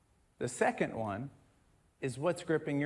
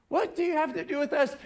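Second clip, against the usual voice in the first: rattled off fast; quick read; 11 semitones higher, faster than usual. Shown as a demonstration. Preacher rushing through the demon's question for contrast, fast. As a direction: say it quickly, like a passing line. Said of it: "if you read it slow".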